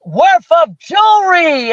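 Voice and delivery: high, slow game-show flourish; prize announcement. Retold or imitated. Host revealing what she won, drawn-out excitement. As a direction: draw out each word like a grand reveal.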